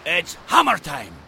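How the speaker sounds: loud, high, gleeful; battle cry. Acted. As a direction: shout it charging in. Dwarf charges into battle.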